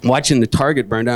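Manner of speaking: ordinary delivery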